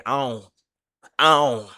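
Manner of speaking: "I don't" run together as one nasal sound, like "ion"; "I don't" with the d and t dropped; slurred "I don't". Shown as a demonstration. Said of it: "I say".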